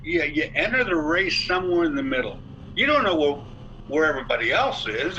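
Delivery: ordinary talk, nothing marked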